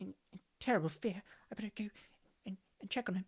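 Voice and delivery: usual speaking voice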